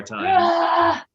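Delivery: very high, loud, slow roar; dragon roar. Shown as a demonstration. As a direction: roar it as loud as you can. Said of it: "Dragon roar".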